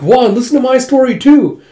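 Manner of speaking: high, loud acted whine for attention; loud drunken plea. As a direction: clamour to be heard like a drunk.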